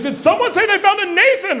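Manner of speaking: very high, urgent shout; frantic dad. Retold or imitated. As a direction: call it out like a desperate parent. Frantic dad sprinting out, retold.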